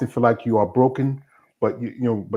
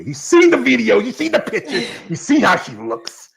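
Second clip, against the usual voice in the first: high, laughing mock warning; laughing tease; 11 semitones higher, 6 dB louder than usual. Real, not acted. Host laughing as he warns viewers about the guest. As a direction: laugh while teasing the freaks. Teasing warning to online freaks.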